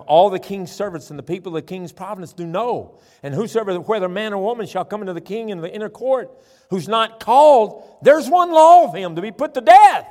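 reading that climbs to a high, loud finish; building scripture reading. Real, not acted. Preacher reading the king's law to the congregation, building forcefully. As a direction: build from measured reading to full-force declaiming.

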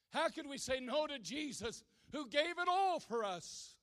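loud, high fervent preaching; preaching at full force. Acted. Preacher building to a fervent climax, loud. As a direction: drive it home to the congregation.

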